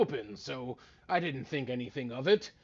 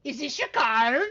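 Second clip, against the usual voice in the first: card magician patter; 7 semitones higher, 6 dB louder than usual. Acted. Magician reveal.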